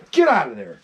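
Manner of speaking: ordinary delivery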